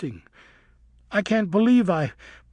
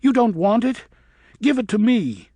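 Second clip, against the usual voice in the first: higher, louder furious demand; snatch at what he won't use; fierce demand; 10 semitones higher, 8 dB louder than usual. A narrator's reading. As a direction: bat demanding his life in rage. Luna attacking Griffin.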